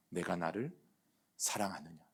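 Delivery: soft, low and hushed, asking tenderly; gentle question of the Lord. Acted. The Lord quietly asks the disciple if he loves him, tender hush. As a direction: ask it quietly like a loving master.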